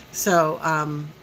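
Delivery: ordinary delivery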